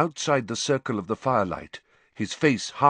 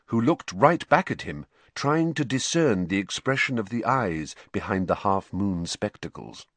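plain reading voice

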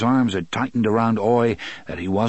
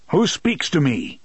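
shouted challenge; 6 semitones higher than usual. A narrator's reading. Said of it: "he shouted".

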